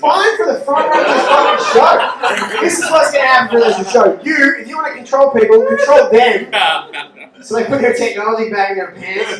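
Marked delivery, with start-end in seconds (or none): 0.4-6.5 mock scolding. Comic scolding the front row.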